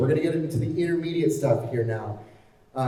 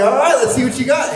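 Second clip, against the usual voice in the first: laughing; 12 semitones higher, 8 dB louder than usual. Retold or imitated. Laughing reply to the guitarist.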